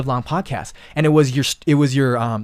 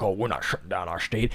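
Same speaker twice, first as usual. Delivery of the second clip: mock proud governor; 7 semitones lower, 11 dB quieter than usual.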